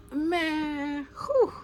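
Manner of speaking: high cracking exhale near tears; voice breaking. Real, not acted. Upset thinking about hurt children, voice breaking. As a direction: let the voice crack on the breath.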